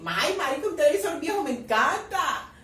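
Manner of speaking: loud, high, hammed-up delight; over-the-top acted excitement; mock gushing. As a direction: gush loudly, stretching the last word. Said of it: "Ahora le va a decir".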